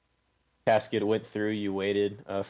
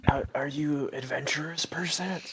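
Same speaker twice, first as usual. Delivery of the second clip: put-on high, stammering old farmer voice; hesitant question, rising high at the end; 7 semitones higher than usual. Old farmer asks if they are adventurers, high and halting. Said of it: "to be an old farmer".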